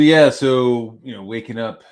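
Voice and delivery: ordinary talk, nothing marked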